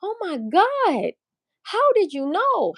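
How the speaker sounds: high, slow, drawn-out astonishment; imitated amazement. Gift receivers amazed she knew, mock astonishment. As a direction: mimic a gift receiver gushing slowly up high. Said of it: "they be like".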